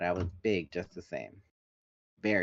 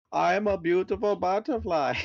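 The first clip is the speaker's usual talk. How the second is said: high, loud, put-on cartoon voice; movie character quote; 9 semitones higher, 5 dB louder than usual. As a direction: squeal it like a proud little bug. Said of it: "reminded me of A Bug's Life".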